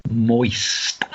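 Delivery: MOIST said low with a long hissed ST; savoured word. Shown as a demonstration. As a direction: relish MOIST, stretching the end. Echoing the word, drawn out.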